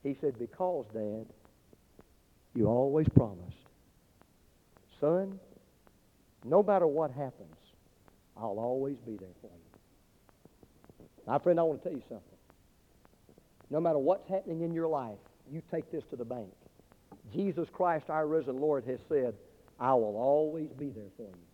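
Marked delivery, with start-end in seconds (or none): 0.4-9.5 put-on tender child. Boy quietly recalls the promise. 11.3-21.5 gentle closing appeal. Real, not acted. Preacher gently applies the story to his listeners, soft and low.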